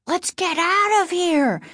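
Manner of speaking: high-pitched panicky plea; scared boy's urgent wail; frightened cry. A narrator's reading. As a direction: cry out, desperate to flee. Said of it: "Casey cried, grabbing Margaret's hand in fear".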